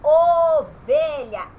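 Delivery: "ovelha" stretched with a rounded "o"; loud, very slow "ovelha", first vowel like "oh"; closed O sound. Shown as a demonstration. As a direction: draw out the closed "o" opening "ovelha". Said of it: "Eu estou falando esse O".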